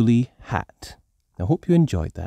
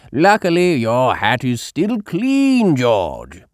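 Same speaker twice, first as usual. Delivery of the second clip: put-on slow, louder old grandpa; Grandpa Pig voice; 4 semitones higher, 6 dB louder than usual. A narrator's reading. Grandpa Pig reassuring George his hat is clean. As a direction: reassure the little one. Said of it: "says Grandpa Pig".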